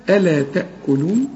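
ألا تأكلون with every letter thin, ألا not heavy; thin recitation. Shown as a demonstration. Teacher demonstrating the question to a student, all letters thin. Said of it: "وكل الحروف مرققة".

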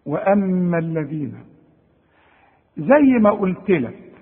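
ordinary talk

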